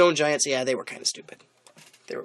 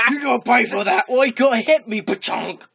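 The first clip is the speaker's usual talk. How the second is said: high, loud put-on giant voice; playful giant; 11 semitones higher, 9 dB louder than usual. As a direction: taunt loudly, then make the boulder noise. Voicing giants' boulder fight.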